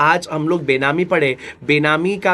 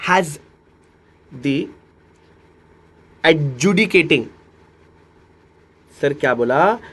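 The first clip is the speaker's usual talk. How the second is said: very slow with long pauses; teacher dictating the next question; slow dictation; much slower than usual. Shown as a demonstration. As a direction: spell out the question word by word. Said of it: "teacher dictating question slowly".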